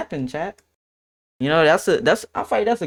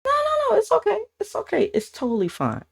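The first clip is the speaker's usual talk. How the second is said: put-on much higher, reassuring girl's voice; 3 dB quieter than usual. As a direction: brush it off in a high sweet voice. Ex-girlfriend reassuring him.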